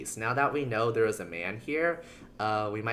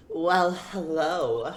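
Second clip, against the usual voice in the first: sultry witness; 5 semitones higher, 3 dB louder, much slower than usual. Acted.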